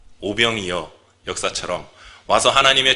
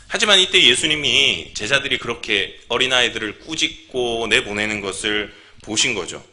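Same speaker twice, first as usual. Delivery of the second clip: ordinary talk, nothing marked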